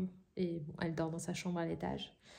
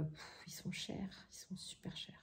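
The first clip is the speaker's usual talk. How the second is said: whispering; 14 dB quieter than usual. Real, not acted. Aside about expensive mugs.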